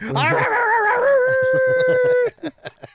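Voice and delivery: high, loud whooping laugh; shrieking laugh. Real, not acted. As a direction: let out a big whooping cackle. Laughing shout after intro.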